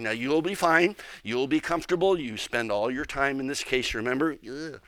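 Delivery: ordinary delivery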